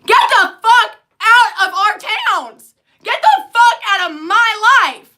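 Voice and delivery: loud, high, slow mock barking; imitating officer's yell. Imitating the officer yelling at the couple, loud. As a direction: bellow like a raging cop. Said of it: "officer is just suddenly starting".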